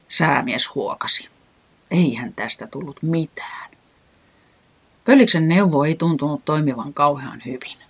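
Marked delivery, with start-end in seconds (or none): none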